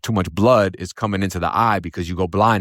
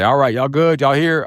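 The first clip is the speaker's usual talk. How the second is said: brisk call, higher and louder; guard's count call; 6 semitones higher, 4 dB louder than usual. Retold or imitated. Guard doing count time, calling out brisk and loud. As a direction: call out the head count down the tier. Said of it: "they come in there and they say".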